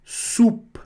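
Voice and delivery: "Soupe" ending on a light p, no vowel; discreet final consonant. Shown as a demonstration. Teacher modelling for students, "Soupe" with a discreet p. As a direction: barely release the p in "Soupe". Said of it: "bem discretamente pronunciada".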